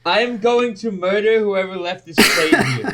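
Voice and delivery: high, loud comic fury; mock murderous outburst. Furious at whoever left the dirty plate, hammed-up anger. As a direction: threaten with hammed-up outrage.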